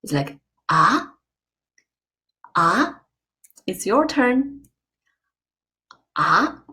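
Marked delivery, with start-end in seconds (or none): 0.7-1.1 "ah" sliding upward; rising tone. Shown as a demonstration. Teacher showing second tone, "ah" going up. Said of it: "The second tone is going up". 2.5-3.0 "ah" sliding upward; rising tone. Shown as a demonstration. Teacher showing second tone, "ah" going up. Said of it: "The second tone is going up". 6.2-6.6 "ah" sliding upward; rising tone. Shown as a demonstration. Teacher showing second tone, "ah" going up. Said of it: "It's going up".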